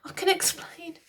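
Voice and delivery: high, hurried spluttering queen; flustered, caught out; high, spluttered excuse. A narrator's reading. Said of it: "she spluttered".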